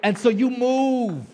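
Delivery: loud, high, fervent preaching climax; rising revival preacher; preaching at full force. Acted. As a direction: drive the congregation to move, slow and loud.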